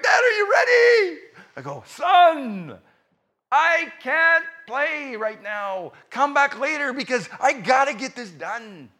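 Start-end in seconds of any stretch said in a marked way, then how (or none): 0.0-1.2 put-on very high, loud kid call; excited child's shout, put on; eager son. Son returns, eager to play. 1.9-8.9 slow, drawn-out acted refusal; heavy, spaced-out words, higher pitch; exasperated dad. Busy dad refusing his son again.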